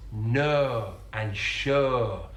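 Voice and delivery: "no and sure" with a long fronted "er" for O, like "ner"; elongated Yorkshire O. Retold or imitated. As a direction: drag out "no" and "sure" on one vowel. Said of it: "you can hear the elongation of another diphthong".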